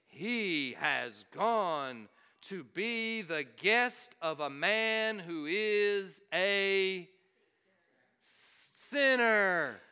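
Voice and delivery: very slow, disapproving low grumble; grumbling crowd. Retold or imitated. Crowd muttering about Jesus visiting a sinner, slow low grumble. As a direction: drag it out like a scandalised crowd. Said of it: "They began to grumble".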